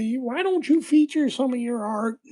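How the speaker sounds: high, loud nagging mom. Retold or imitated. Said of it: "She said".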